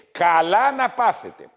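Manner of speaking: usual speaking voice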